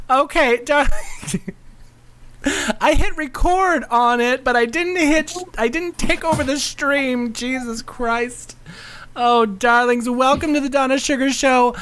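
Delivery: lightly high diva host; femme fatale. Acted. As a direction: coo at the darlings. Hosts in her show persona.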